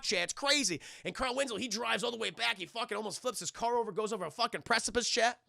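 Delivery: ordinary talk, nothing marked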